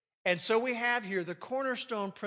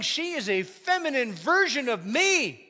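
excited; 6 semitones higher, 9 dB louder than usual. Acted. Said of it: "He was saying".

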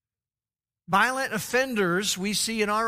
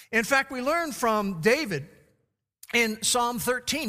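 ordinary talk, nothing marked

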